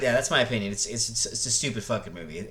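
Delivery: ordinary talk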